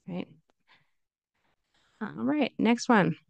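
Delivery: normal talking voice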